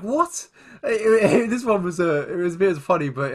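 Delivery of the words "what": high, startled what; a high squeak of disbelief; baffled what. Retold or imitated. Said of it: "I was like".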